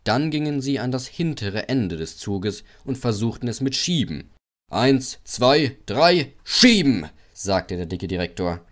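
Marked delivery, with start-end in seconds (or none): 4.7-7.1 loud count-in, last word drawn out; anfeuerndes Kommando. A narrator's reading. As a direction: count them in, then call the push loudly. Direktor gibt das Kommando. (lautes Kommando zum Anschieben)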